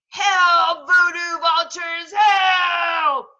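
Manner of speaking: high, loud shouted plea; yelling for help. Acted. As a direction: yell for the flock. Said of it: "yelled Victor".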